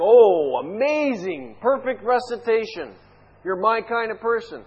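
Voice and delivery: sarcastic gushing praise, loud first word; mocking God's approval. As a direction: fake being thrilled by a recital. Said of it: "you're making a mockery of this".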